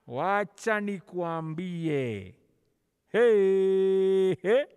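ordinary talk

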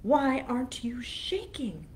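whispered troll taunt; menacing whisper. Acted. Troll taunting the goat, menacing whisper. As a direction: hiss the question to scare him.

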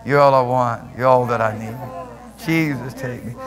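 low, hushed worship prayer. Real, not acted.

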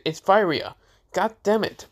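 voiced girl losing patience over her name; exasperated. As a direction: correct him, fed up, pitch jumping up.

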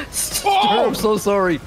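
giggly apology. Real, not acted. Apologising after killing teammate.

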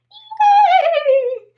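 high, loud yay; cheer. Real, not acted. Teacher cheers after the song, high and loud.

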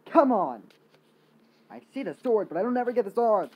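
loud exasperated shout; frustrated yell. Real, not acted. Frustrated at game.

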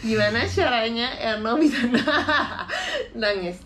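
real laughter interrupting the words; laughing while talking. Real, not acted. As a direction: crack up mid-question. Cracks up at crying challenge.

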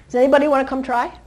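normal talking voice

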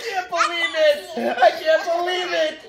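high, squealed amazement; thrilled disbelief. Real, not acted. Parent stunned by coupon deal, high excited squeal. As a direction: squeal it as if overwhelmed.

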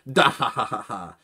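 light hammed-up dahaha; mock laugh. Aokiji laughing back over drinks, light mock laugh. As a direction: laugh along lazily.

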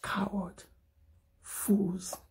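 breathy hissed coward; bare whisper of contempt; whispered insult. Real, not acted. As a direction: spit it out under your breath.

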